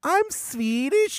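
put-on high, drawn-out comic Swedish accent; mock Swedish accent. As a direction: ham up a muddled Swedish accent. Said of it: "we were doing a Swedish accent".

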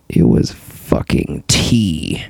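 breathless, hushed disbelief; stunned whisper at the cup. Real, not acted. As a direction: whisper it like you can't believe it. Disbelief at getting tea.

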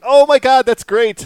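high, loud gleeful exclamation; excited shout. Retold or imitated. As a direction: burst out in delight. Retold delight at Wilhelm scream.

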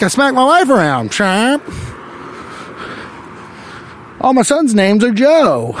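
hammed-up 1920s newsroom tough guy; 1920s dapper man. Comic playing a 1920s husband, hammed-up gangster voice.

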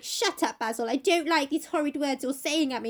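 mock tantrum, lightly hammed up; hissy-fit Dorian. Dorian rejecting the painter's horrid words, hissy fit. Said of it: "like, a hissy fit with Basil".

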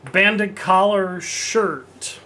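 very slow, savoring each word; drawn out. Acted. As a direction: stretch it out slowly. Slow naming take.

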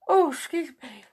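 through a yawn. Real, not acted. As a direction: say it mid-yawn. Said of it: "I'm yawning again".

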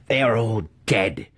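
high, cruel taunt; the master taunting the doomed student. A narrator's reading. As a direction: twist the knife. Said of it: "the faceless one taunted".

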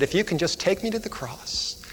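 put-on soft, slowing child's plea; slow down and soften toward the last words; tender, hushed delivery, sinking low. Girl's plea repeated, story's climax.

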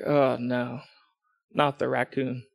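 low, soft "uh-oh" to himself; hushed dread. Retold or imitated. Man hears rustling by his trash cans, hushed dread. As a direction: let it sink in low and quiet.

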